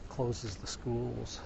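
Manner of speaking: ordinary talk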